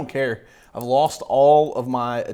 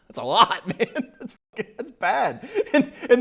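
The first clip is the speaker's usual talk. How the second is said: laughing; 11 semitones higher than usual. Real, not acted. Laughing at the script.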